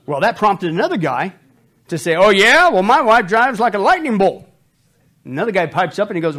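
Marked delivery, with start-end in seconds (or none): 2.1-4.5 loud one-upping brag. Retold or imitated. Guy at party one-upping.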